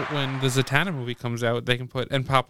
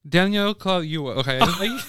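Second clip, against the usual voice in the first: ordinary talk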